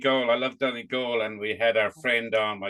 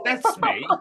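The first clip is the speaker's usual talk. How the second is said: proud admission; 12 semitones higher, 3 dB louder than usual. Retold or imitated. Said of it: "I said".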